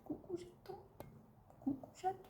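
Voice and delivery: high, very soft cooing. Real, not acted. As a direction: sweet-talk the kitten. Greeting the kitten.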